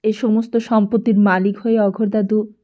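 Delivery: ordinary delivery, nothing marked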